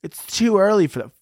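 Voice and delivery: mock prissy complaint; mocking whiny voice. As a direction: mimic a fussy complainer. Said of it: "the people that are like".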